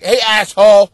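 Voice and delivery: imitating a coworker's loud, teasing scolding; mock loud scolding. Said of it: "She was like".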